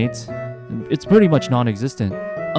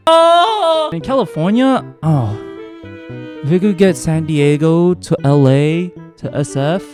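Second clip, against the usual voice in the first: excited shout; 5 semitones higher, 6 dB louder than usual. Real, not acted. Excited about California rail.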